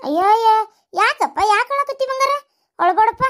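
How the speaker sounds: high, sing-song fussing; cooing to child. Acted. Mother to her crying darling, cooing. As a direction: coo with exaggerated concern.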